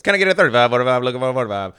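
loud, rapid auctioneer calling bids. Pretend. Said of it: "My auctioneer voice".

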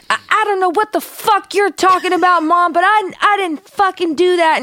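high-pitched, agitated denial; worked-up protest. Retold or imitated. Daughter protests to her mom over an accusation, worked up. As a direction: imitate an upset, yelling daughter. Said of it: "she's so worked up".

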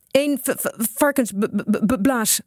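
high, stuttered attempt to get words out; stuttering. A narrator's reading. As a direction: stammer on the first consonants. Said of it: "stotterde Kees". (stotterend, met hoge stem)

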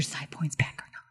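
quick whispered aside. Real, not acted. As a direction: whisper it fast. Side remark unvoiced.

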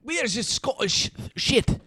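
high, slow comic Scottish voice; exaggerated Scottish parody; mock Scottish accent. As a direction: stretch out a joking Scottish brogue. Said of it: "learn a Scottish accent".

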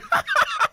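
high loud burst of laughter. Real, not acted. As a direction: cracks up laughing. Laughs at own joke.

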